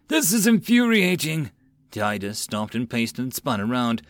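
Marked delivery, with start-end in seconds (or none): 0.1-1.5 high, raised, furious outburst; a young lord fuming at his treatment. A narrator's reading. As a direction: spit out your fury at the insult. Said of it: "paced angrily".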